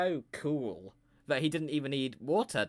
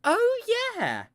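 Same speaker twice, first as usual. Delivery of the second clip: very high, loud squeak; squeaky agreement; 8 dB louder than usual. Acted. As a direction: pop the words out in a falsetto yelp. Squeaky reaction.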